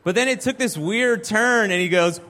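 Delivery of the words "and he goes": normal talking voice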